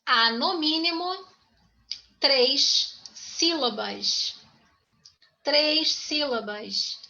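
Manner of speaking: três sílabas drawn out very slowly for note-taking; slow dictation. Shown as a demonstration.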